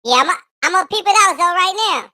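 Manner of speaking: very high chipmunk voice with laughs. Real, not acted. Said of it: "it's a kid voice".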